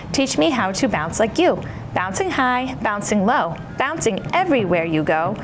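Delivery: plain reading voice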